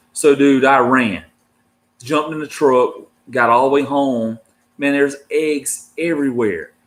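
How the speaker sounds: usual speaking voice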